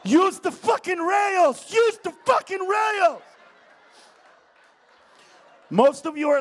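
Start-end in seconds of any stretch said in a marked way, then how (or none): 0.0-3.2 slow, loud yelled order; shouted instruction. Retold or imitated. Comic yelling advice at the falling president, slow shout. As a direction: yell it slowly, exasperated.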